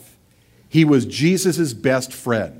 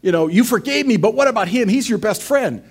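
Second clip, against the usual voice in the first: higher, whiny complaint to the Lord; jealous Peter; 8 semitones higher than usual. Acted. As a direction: needle the Lord like a jealous friend. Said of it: "looks over his shoulder and says".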